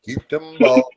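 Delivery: high, loud hollered cheering; hyped cheer. Pretend. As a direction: cheer like a rowdy fan in the stands. Mock cheering at a game.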